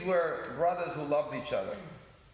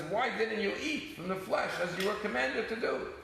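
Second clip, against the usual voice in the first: pitched up, a hint of an angry brother; mock angry rebuke; 6 semitones higher than usual. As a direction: chide him, half acted. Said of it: "Moshe got angry at his brother".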